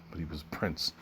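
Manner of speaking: ordinary delivery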